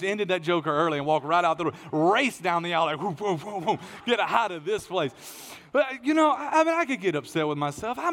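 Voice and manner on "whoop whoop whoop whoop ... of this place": higher, quick whooping getaway noise; mock escape whoops. Preacher racing down the aisle after sermon, mock escape whoops. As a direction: whoop like you are fleeing the room.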